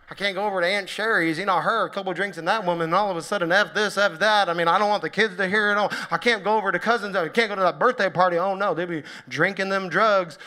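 hammed-up Southern accent, lively complaining. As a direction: play a fussing Southern church member. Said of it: "I don't know why I have a Southern accent either when I'm doing it".

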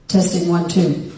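loud, low mic test call; mic check. Real, not acted. Pastor testing the mic, loud and low. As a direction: boom it into the mic.

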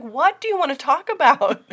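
high, loud, exasperated challenge; exaggerated fed-up question; rude impatience. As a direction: imitate her losing patience. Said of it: "I'm being ruder than she was".

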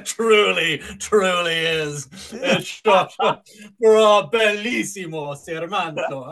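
loud, slow, hammed-up solemn affirmation; mock grand agreement. Imitating everyone agreeing pompously, loud and hammed-up. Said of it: "And everyone goes".

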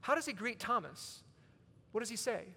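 usual speaking voice

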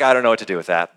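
low, deflated mock puzzlement; acted bafflement. Reader walking away from a hard passage, mock baffled. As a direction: drop well down in pitch, giving up. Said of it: "But I walk away going".

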